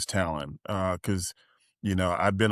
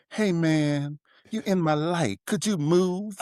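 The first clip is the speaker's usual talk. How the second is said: lightly voiced casual star, a bit higher; mock a celebrity shooing the photographer; 7 semitones higher than usual. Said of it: "he goes".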